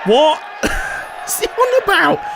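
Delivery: a startled shout of disbelief; baffled shout. Real, not acted. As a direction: yelp it out in disbelief. Baffled outburst at the rooster.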